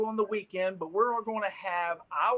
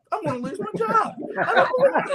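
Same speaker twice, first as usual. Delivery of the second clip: mock panic; 5 dB louder than usual. Panicking on the phone, retold.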